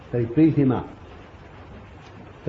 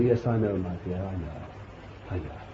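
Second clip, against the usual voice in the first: much softer, lower, fading yes I know; soothing reassurance; 7 semitones lower, 10 dB quieter than usual. Retold or imitated. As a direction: comfort her gently, trailing off. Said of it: "And I say".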